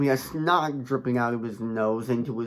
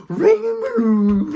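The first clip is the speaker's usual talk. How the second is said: high, loud mock squeal; hammed-up high cry; put-on gleeful squeal; 8 dB louder than usual. Acts out the clown's excitement.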